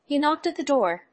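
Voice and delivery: plain reading voice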